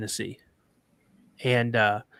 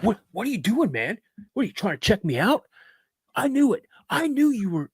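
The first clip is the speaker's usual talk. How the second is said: high-pitched, incredulous accusing brother; mock accusation; 8 semitones higher than usual. Brother accuses him of checking him out, mock accusing. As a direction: act out his accusation, voice pitched way up.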